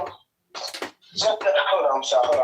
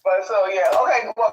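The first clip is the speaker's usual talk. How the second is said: ordinary talk, nothing marked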